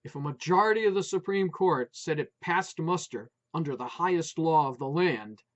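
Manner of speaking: plain reading voice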